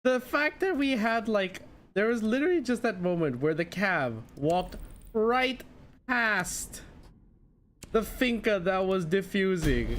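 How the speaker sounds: high, excited disbelief. Real, not acted. Excited rant about teammate's mistake.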